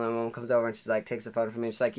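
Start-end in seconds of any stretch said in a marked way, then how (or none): none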